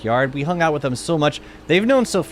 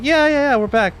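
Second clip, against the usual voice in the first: mock cheerful reply; 12 semitones higher, 4 dB louder than usual. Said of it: "It's like".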